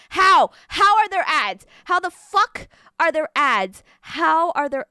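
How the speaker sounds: loud, high disbelief; shocked outburst. Real, not acted. Streamer shocked to see ads, loud disbelief. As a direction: demand to know, loudly.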